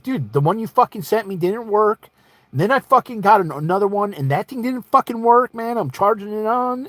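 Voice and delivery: high-pitched, agitated complaint; exasperated protest. Acted. Customer telling seller the replacement didn't work, exasperated. Acted argument over broken scanner.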